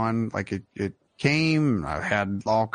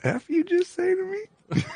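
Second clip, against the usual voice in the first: put-on sassy teen voice. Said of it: "cocking my head to the side".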